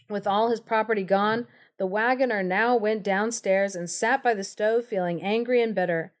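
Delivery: plain reading voice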